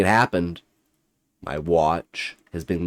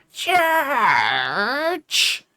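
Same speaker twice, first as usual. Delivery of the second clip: high, shrieked ghostly cry; spat word. Acted. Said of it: "as it spat that word".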